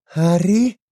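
put-on high, loud stage whisper of a girl; questioning high call. A narrator's reading. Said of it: "se oyó susurrar en la oscuridad".

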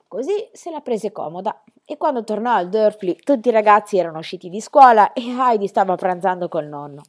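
ordinary delivery, nothing marked

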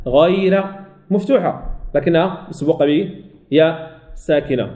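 normal talking voice

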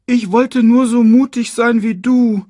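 put-on high, loud young lion voice; earnest young son. A narrator's reading. Lion cub explaining himself to his disappointed father, high and pleading. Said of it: "versuchte er zu erklären".

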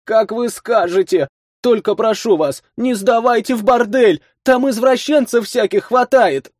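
lightly voiced tearful girl; high girl voice near tears. A narrator's reading. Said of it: "всхлипнула".

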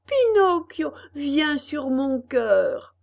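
fort et aigu, très lent sur la fin; cri d'Arlequin. A narrator's reading. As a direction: appelle à bras ouverts. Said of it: "cria Arlequin". (Arlequin appelle Pinocchio, fort et chaleureux)

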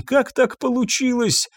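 high-pitched anguished question; wailing disbelief, pitched right up; distraught insistence. A narrator's reading. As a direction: ask it as a high lament, without letting up. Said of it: "не унималась Ирен".

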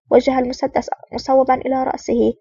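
usual speaking voice

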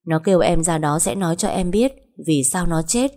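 ordinary delivery

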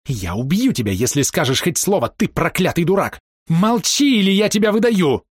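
high threat building to a cry; furious threat. A narrator's reading. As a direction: threaten them into silence. Фрэнсис угрожает, зажимая рот. (яростная угроза)

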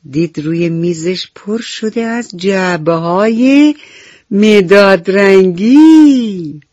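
storyteller's build to a loud, drawn-out finish; wondering reveal, swelling at the end; delighted reveal. A narrator's reading. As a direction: build up, then stretch out the surprise loudly. Narrator reveals the happy surprise.

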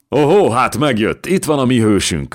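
loud, high hearty welcome; booming greeting. A narrator's reading. Old Újlaki welcoming the hero, booming. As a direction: boom it out across the hall. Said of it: "harsogta az öreg újlaki".

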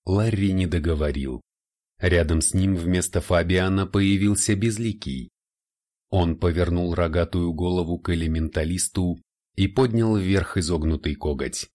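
ordinary talk, nothing marked

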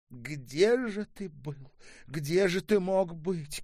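slow, high, loud lament; anguished questioning. A narrator's reading. Mother shaking her head at returned son, anguished. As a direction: draw out the question, wailing it.

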